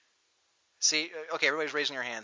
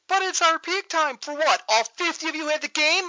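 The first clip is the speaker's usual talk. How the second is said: high-pitched, mocking imitation of complainers; mock complaining players; 11 semitones higher, 4 dB louder than usual.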